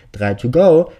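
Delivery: loud, high triumphant gloat; gloating cheer. Acted. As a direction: crow it out over the body. Killer gloats after the shot.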